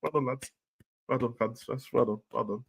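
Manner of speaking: soft, flat, bored well done; mock limp praise. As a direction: mimic a half-hearted polite clap. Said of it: "we did a boring clap".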